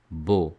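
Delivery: Beau said as bo, x silent; teacher models Beau; silent letters demo. Shown as a demonstration. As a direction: say Beau as a plain o. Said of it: "it is pronounced beau".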